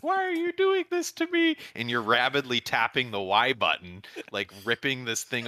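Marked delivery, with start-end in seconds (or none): 0.0-1.6 very high-pitched mock wail; put-on high voice, pretending to cry; crying stuffed elephant. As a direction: imitate a pleading toy in a squeaky, tearful voice. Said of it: "crying and, like, being like".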